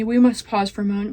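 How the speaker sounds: ordinary delivery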